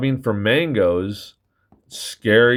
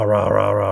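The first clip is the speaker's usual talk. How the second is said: rumbling mock snores; playful growly snore sounds; snore imitation; faster than usual. Shown as a demonstration. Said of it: "it sounds like rah".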